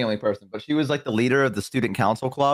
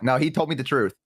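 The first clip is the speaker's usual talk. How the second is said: normal talking voice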